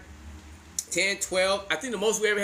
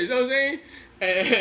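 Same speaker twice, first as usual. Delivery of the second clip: ordinary delivery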